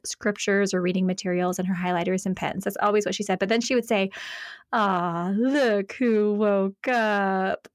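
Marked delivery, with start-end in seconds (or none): 4.7-7.7 drawn-out, adoring coo; doting mother's greeting. Retold or imitated. Mom greeting her child first thing in the morning, doting. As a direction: slowly savor each word like a delighted mom. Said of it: "that's exactly how she would say it".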